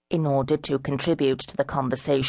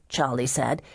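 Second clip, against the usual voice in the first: ordinary delivery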